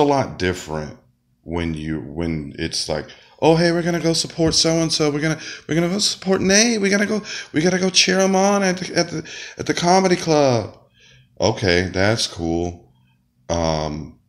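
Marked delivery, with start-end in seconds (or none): 3.4-10.8 high, hammed-up eager friend; mock friend voice. Comic mocking friends who support other acts, high and giddy. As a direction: squeal it like a giddy pal heading to the club.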